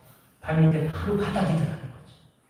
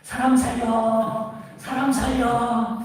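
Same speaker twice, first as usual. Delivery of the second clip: higher, slow half-sung call for help; mock cry of help again; storyteller imitating a man shouting for rescue, pitched up; 7 semitones higher, much slower than usual. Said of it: "다시 소리를 지르죠".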